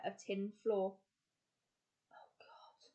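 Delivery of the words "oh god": breathless whisper under the breath; whispered mutter. A narrator's reading. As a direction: barely breathe it out. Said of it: "muttered Fred".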